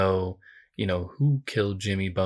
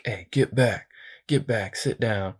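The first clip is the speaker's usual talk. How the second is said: lightly acted warning, slightly raised pitch; retold order; 2 semitones higher than usual. Bodyguard warning a man off, mock commanding. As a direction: imitate a guard ordering someone back.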